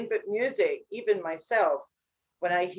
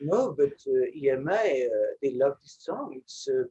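imitating record label; 5 semitones lower than usual. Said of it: "They were like".

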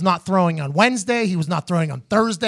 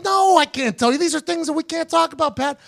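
1 mocking high voice; 10 semitones higher than usual. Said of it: "He's like".

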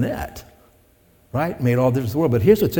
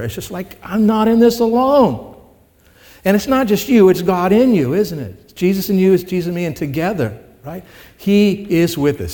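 fervent preaching; 6 semitones higher, 3 dB louder than usual. Acted. Preaching at full force.

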